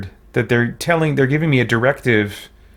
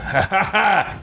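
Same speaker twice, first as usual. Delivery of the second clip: high, bright burst of laughter at the game; a real amused cackle; 6 semitones higher than usual. Real, not acted. Streamer mocking the dialogue option.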